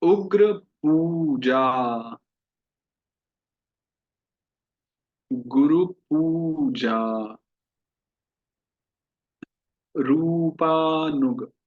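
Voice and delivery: each word drawn out very slowly, syllable by syllable; "उग्र पूजा, गुरु पूजा, रूपानुग" recited very slowly and carefully; slow recitation drill. Shown as a demonstration. Teacher leading a Sanskrit pronunciation drill, very slow. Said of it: "अब mix practice".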